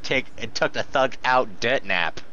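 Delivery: ordinary talk, nothing marked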